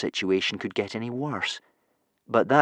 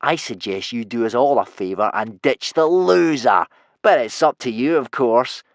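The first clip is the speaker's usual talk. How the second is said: purring menace; 3 semitones higher, 3 dB louder than usual. Acted. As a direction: toy with the victim, stretching words. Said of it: "purred Snotlout".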